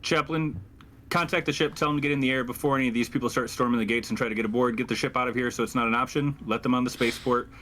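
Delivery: normal talking voice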